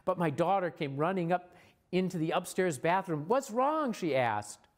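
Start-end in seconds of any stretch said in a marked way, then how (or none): 3.3-3.9 high, worried retold question; alarmed child's question. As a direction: imitate a daughter asking in alarm. Daughter's alarmed question, acted.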